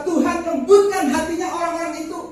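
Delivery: loud, high-pitched plea to God; pleading prayer. Retold or imitated. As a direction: re-enact an insistent, exasperated prayer. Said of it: "Berkali-kali saya berdoa".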